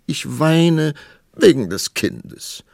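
high, loud tearful complaint; sobbing, acted. A narrator's reading. Queen telling the king why she weeps, sobbing. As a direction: wail it, dragging the last words. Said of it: "schluchzte die Königin".

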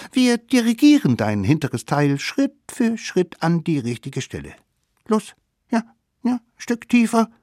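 put-on sly children plotting a trick; high scheming kid voice; little wild kids. Kids guiding mammoth.

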